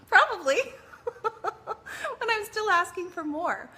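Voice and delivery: high laughing admission; cheeky laughing. Real, not acted. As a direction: own it with a giggle.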